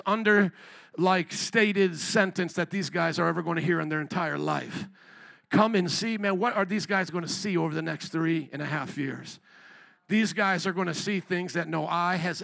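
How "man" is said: ordinary talk, nothing marked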